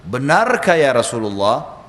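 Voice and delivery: usual speaking voice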